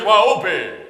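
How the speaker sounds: loud mock-preacher delivery; hammed-up preacher addressing a boy; preacher's voice. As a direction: boom it out like a pulpit preacher. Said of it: "That's the way he talks".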